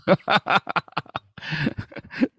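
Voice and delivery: a high, bright laugh at his own embarrassment; high burst of laughter. Real, not acted. Preacher laughing at being chased for pledge money, high laugh.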